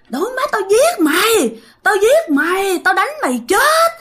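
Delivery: high-pitched acted death threats; loud cursing. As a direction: rant like someone cursing wildly. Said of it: "chửi um sùm hết".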